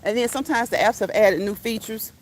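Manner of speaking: ordinary talk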